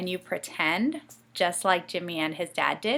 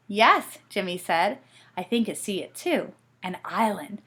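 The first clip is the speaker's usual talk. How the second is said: lively storybook reading with voices; reading to children; 2 semitones higher, 5 dB louder than usual. A narrator's reading. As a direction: read it like a bedtime story.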